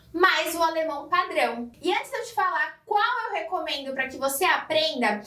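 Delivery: ordinary talk, nothing marked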